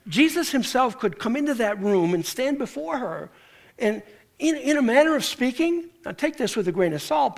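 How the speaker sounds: ordinary delivery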